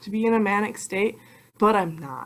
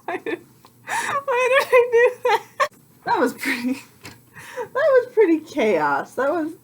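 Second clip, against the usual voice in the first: much higher, squealing embarrassed whine; cringing laugh-cry. Real, not acted. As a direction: squeal through laughter at yourself. Cringing at her own video.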